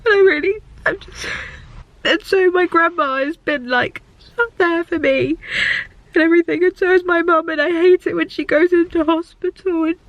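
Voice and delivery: high voice, talking through tears; real tearful upset. Real, not acted. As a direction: keep going while crying for real. Crying about family in hospital.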